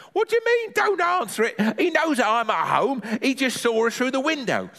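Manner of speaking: very high granny retort; indignant granny. Acted. Granny scolds boy hiding from doorbell, high indignant retort. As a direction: throw the words back in a high granny voice.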